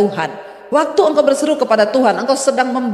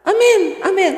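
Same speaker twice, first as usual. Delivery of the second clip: called amen; 8 semitones higher than usual. Shown as a demonstration.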